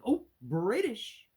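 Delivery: loud, high, drawn-out mock outburst; mock sudden surprise. Vlogger mocking a jarring British narrator, loud exaggerated surprise. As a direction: blurt it high and stretch BRITISH. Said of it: "have me just suddenly like".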